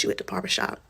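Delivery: hushed, breathless whisper of disbelief. Retold or imitated. Quizzing her husband on the phone, whispered disbelief. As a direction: whisper it like you can't believe it.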